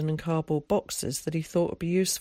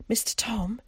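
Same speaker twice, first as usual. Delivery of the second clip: put-on timid, higher-pitched child's question; small, tentative high voice; 6 semitones higher than usual. A narrator's reading. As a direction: ask shyly, voice raised. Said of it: "he asked timidly".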